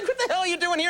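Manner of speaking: high, laughing disbelief; incredulous laughing. Retold or imitated. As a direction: laugh out the shocked question. Retold disbelief at the diner.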